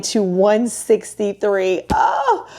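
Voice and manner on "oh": high "oh" at the big drop; high-pitched exclamation. Real, not acted. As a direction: let out a very high oh. Reacting to big carb cut.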